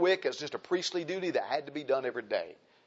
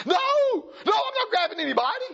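high, loud mock protest; indignant denial; 5 dB louder than usual. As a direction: act out a shocked, defensive no.

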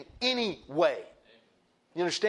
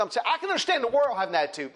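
ordinary talk

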